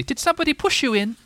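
very high, squeaky little character voice; eager, tiny animal voice; excited. A narrator's reading. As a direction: ask it in thrilled falsetto. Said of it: "said Roo excitedly".

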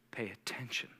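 very soft and low; loving, gentle tone. Acted. Preacher giving the Lord's message to listeners, hushed and gentle. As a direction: say it gently, almost under the breath. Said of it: "the loving and gentle tone of the Lord".

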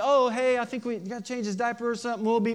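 high, hurried mock-casual excuse; parent making excuses. Parent excuses family from Santa, high and hurried. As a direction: imitate a parent improvising a quick getaway. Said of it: "you kinda do the parent thing".

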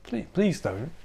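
soft, small pleading; hushed retold begging; meek plea. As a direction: beg quietly, barely pushing the voice. Acted plea against burning town down.

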